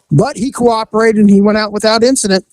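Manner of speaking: usual speaking voice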